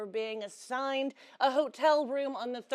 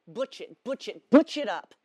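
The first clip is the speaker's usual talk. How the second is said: butch self-command; 8 dB louder than usual. Acted. Self-commands to act more masculine.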